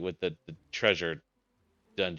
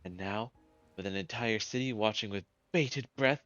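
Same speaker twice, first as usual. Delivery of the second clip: high-pitched, theatrical would-be savior; pompous hero's speech, pitch easing down as it goes; self-important hero; 6 semitones higher than usual. Acted. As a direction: declaim grandly to your adoring public, starting high. Hero declaiming to the city.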